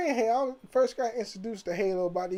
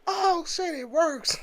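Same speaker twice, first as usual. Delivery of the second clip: high, surprised "oh shit, it works"; thrilled the console finally boots; excited disbelief; 10 semitones higher, slower than usual. Retold or imitated. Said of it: "I was like".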